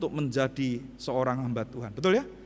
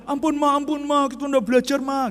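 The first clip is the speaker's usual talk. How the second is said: higher, louder begging; pleading child; 8 semitones higher, 5 dB louder than usual. Retold or imitated. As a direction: beg mother for mercy. (anak memohon ampun, lebih tinggi)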